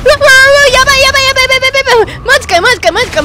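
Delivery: high, loud frantic repeats; terrified player yelling at a sudden monster; panicked yelling. Real, not acted. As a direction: freak out at the monster. Huge monster appears suddenly.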